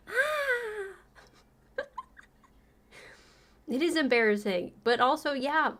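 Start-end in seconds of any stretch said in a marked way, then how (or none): 0.1-0.9 long, very high "ah"; high mock wail. Squeals after mocking their anger.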